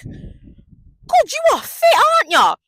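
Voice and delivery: gushing admiration. Retold or imitated. Gushing over handsome cousin.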